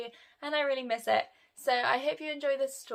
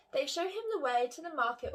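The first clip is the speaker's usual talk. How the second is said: ordinary delivery, nothing marked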